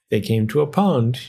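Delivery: ordinary delivery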